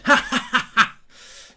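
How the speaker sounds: loud real laugh. Real, not acted. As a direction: crack up at the comment. Laughs at comment.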